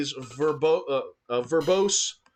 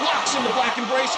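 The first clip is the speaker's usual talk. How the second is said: excited call; 11 semitones higher, 5 dB louder than usual. Acted. First call of the submission.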